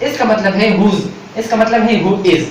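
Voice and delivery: ordinary delivery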